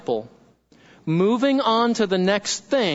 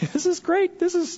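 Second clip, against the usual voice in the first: laughing; 9 semitones higher than usual. Real, not acted. Preacher laughing with delight.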